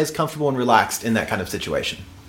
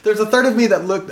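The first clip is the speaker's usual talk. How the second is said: ordinary talk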